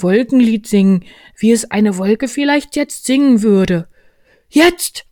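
high, loud bear, eagerly directing; Winnie der Pooh. Acted. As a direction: lay out the plan, then cue sharply. Voices Pooh in story. (hohe, laute Bärenstimme, eifrig)

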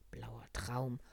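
ordinary delivery

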